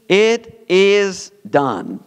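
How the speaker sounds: very slow emphatic declaration; slow, weighty. Acted. Preacher reading God's final words, slow and weighty. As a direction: let each word land slowly.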